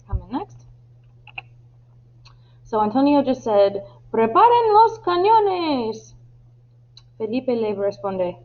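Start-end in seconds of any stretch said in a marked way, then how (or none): 4.1-6.2 high, drawn-out command; shouted order. Acted. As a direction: call it out as a captain's order. Captain's order re-enacted. (orden de capitán, aguda y alargada)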